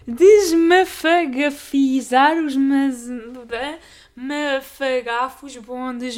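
very slow, effortful tongue-twister reading; painfully slow "Desmafagafizar"; struggling slowly. Shown as a demonstration. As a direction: drag each syllable out while fighting the words.

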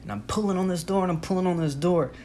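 ordinary talk

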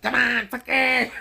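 very high, loud, slow taunting voice; imitating the flasher. Flasher taunting the workers, loud high mock dare. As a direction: dare them in a crazed mock voice. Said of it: "He's like".

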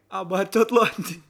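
mock defiant retort. Retelling smokers answering a warning on campus tour, mock defiance. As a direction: push back loudly, playing it up.

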